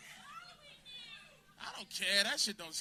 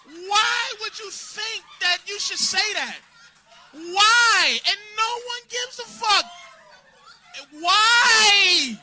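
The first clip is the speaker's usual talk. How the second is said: angry shouting; 7 semitones higher, 9 dB louder, much slower than usual. Real, not acted. Yelling at heckler.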